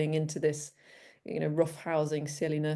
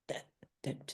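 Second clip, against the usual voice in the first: dog voice; 12 dB quieter than usual. Retold or imitated. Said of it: "for him to be like".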